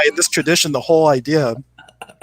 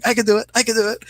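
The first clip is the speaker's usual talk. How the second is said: much higher, squeaky little bird voice, eager; tiny hummingbird voice; 10 semitones higher, 3 dB louder, faster than usual. Retold or imitated. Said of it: "this little hummingbird raising his little feather going".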